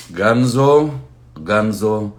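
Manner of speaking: loud, high call of a name. Real, not acted. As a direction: call the name across the room. Calling the fan's name out.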